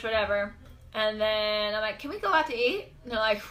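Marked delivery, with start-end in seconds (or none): 2.0-2.9 high-pitched, light question; small, high retold plea; innocent request. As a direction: ask it sweetly, pitched up. Retold innocent request after injury.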